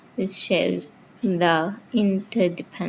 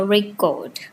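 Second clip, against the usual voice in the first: "record" as re-CORD; second-syllable stress. Shown as a demonstration. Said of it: "Stress falls on the second syllable".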